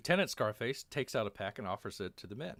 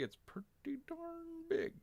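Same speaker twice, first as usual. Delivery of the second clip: slow, stretched words sliding very high; playful drawn-out; 7 dB quieter, slower than usual. Real, not acted. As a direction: draw it out and squeak upward, softly.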